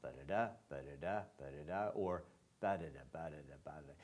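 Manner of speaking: ba-da-da tapped out, stress shifting between beats; soft scat of ba-da-da feet; rhythm syllables. Shown as a demonstration. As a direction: chant ba-da-da like a metre drill. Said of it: "not sure whether it goes".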